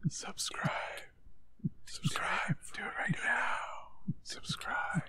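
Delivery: whisper yelling. Pretend. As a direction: hiss it like a shout. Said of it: "Why are we whispering?".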